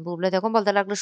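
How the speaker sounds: plain reading voice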